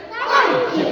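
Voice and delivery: very high, loud "Thank you"; squeaky thanks. Acted. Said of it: "What did he say?".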